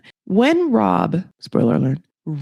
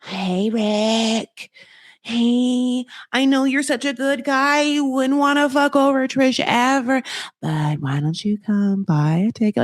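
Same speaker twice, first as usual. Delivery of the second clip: seductive temptress Colombia; 4 semitones higher than usual. Retold or imitated. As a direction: lure him with a put-on coaxing voice. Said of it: "Colombia was just like".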